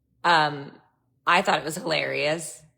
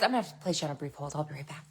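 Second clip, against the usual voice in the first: hurried hold line; 7 dB quieter, much faster than usual. Retold or imitated.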